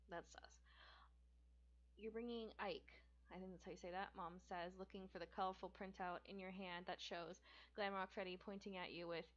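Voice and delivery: plain reading voice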